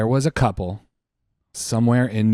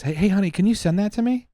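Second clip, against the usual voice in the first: higher, quick mock husband asking sweetly; eager husband; 10 semitones higher, faster than usual. Husband asking wife to send the video, higher and fast. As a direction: rush it out, a fake sweet husband. Husband voiced in retold story.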